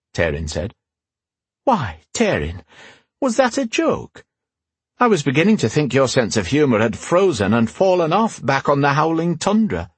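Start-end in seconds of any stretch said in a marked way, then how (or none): 1.6-9.9 high-pitched, playfully mocking queen; teasing queen. Queen ribbing her knight about his humour, teasing. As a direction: feign surprise in a high, teasing voice.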